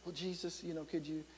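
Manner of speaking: very soft, low, hesitant request; timid. Retold or imitated. Mother imagined asking Jesus a favor, timid and hushed. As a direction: ask meekly, barely voiced. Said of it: "you might think Mary was timid".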